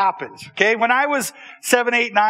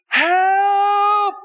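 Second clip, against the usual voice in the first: put-on little boy shouting; 10 semitones higher than usual. Little boy calling for help from the outhouse. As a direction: cry out for help far away. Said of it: "a little boy going".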